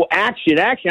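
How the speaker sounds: ordinary talk, nothing marked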